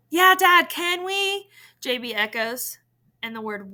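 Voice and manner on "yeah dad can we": higher and louder pleading; eager echo. A narrator's reading. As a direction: jump in begging along. Brother eagerly echoes request.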